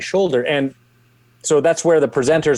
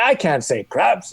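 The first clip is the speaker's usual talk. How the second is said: higher, slow objection in an imitated French accent; Bernie Parent; 6 semitones higher than usual. Hockey legend refusing to say a word, imitated French accent. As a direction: balk at it with a put-on French accent. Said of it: "still got a thick French accent".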